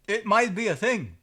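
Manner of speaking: loud, high stress on might; shocked. Real, not acted. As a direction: gasp out might in disbelief. First take, shocked.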